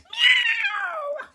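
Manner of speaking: very high, loud cat yowl; enormous meow. Acted. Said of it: "enormous big sound of a cat".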